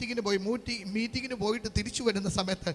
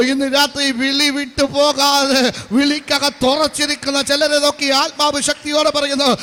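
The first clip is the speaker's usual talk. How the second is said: loud, high prophetic declaration; full-force preaching; 4 semitones higher, 12 dB louder than usual. Real, not acted. Preacher prophesying to those firm in their calling, shouted at full force.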